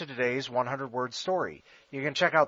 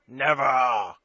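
coughing; 7 dB louder than usual. A narrator's reading. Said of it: "coughed Sir Boltack".

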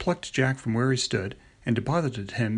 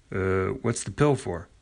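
father voice; 3 semitones lower, slower than usual. A narrator's reading.

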